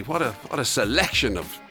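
ordinary talk

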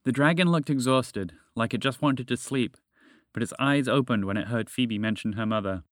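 ordinary delivery, nothing marked

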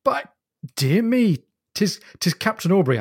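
put-on officer suddenly recognising his old captain; delighted surprise. A narrator's reading. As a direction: cry out in glad surprise. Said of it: "a huge grin, and Faulkner cried".

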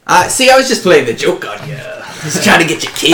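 lightly voiced joking half-orc. Half-orc figure answers the player.

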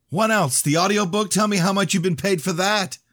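very high, loud interrogation; yelled demand. A narrator's reading. Danielle demanding to know what he was paid, yelling. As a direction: demand answers at the top of the range. Heated quarrel about pay continues.

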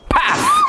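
shouted "Paf!" as the barrier hits; sharp mock crash cry; loud smack sound. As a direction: yell the impact noise.

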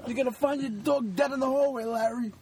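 cranky neighbor. Retold or imitated. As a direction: shout it across the hall like an angry neighbor. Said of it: "It's like".

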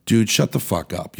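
ordinary talk, nothing marked

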